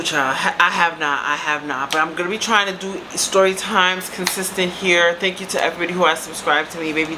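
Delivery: ordinary talk, nothing marked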